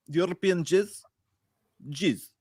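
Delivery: lightly put-on Russian accent; quoting a movie line in a Russian accent; mock Russian accent. Friends goofing in a Russian accent, quoting a movie line. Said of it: "talk in Russian accents".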